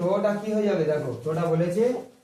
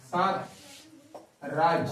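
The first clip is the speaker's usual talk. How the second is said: syllables stretched very long and slow; drawn out name; 3 semitones lower, 4 dB quieter, much slower than usual. Shown as a demonstration. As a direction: pull the word out as far as it goes. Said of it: "বলে যত ইচ্ছে টানা যেতে পারছে". (সাতরাজ শব্দটা খুব টেনে ধীরে বলা)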